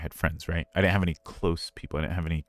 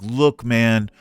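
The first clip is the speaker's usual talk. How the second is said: loud, raised call to attention; retold pep talk; 5 semitones higher, 8 dB louder than usual. Drunk friend rising to encourage speaker, loud pep talk. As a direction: open a pep talk with a punch. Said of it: "super inspirational speech".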